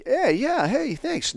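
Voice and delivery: very high, chirpy mock gratitude; suddenly cheered up. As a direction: imitate someone instantly perked up by a treat. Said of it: "that emotional bleed over has gone away".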